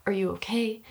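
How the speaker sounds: soft, hushed worried check; concerned. Retold or imitated. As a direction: ask gently if she's hurt. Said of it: "I'm like".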